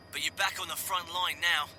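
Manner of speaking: high Cockney soldier voice; clipped Cockney regiment lad; Cockney accent. Acted. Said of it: "he said in a Cockney accent".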